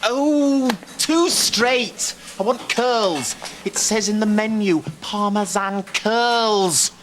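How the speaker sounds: high, exasperated complaint; angry ranting. Acted. Angry man complains about the menu's curls, exasperated. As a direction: rant with drawn-out key words.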